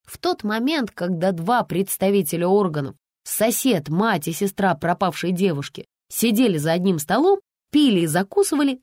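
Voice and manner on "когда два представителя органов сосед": ordinary delivery, nothing marked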